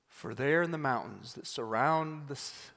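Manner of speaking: usual speaking voice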